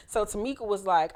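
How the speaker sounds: ordinary talk, nothing marked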